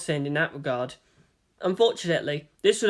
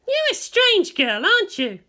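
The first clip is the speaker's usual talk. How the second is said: Asuka's voice; 4 dB louder than usual. A narrator's reading. Asuka needles Rei.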